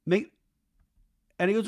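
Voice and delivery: ordinary talk, nothing marked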